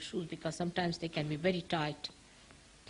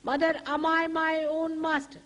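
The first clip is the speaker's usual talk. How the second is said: higher, louder, confident question; with full faith; 8 semitones higher, 5 dB louder than usual. Shown as a demonstration. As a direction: ask it with full faith in yourself. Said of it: "With full faith in yourself".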